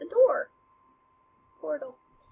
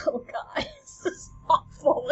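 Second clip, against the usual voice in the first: hammed-up tearful wail; broken, mock sobbing; 4 semitones higher than usual. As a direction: play a mother falling apart. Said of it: "who's, like, broken".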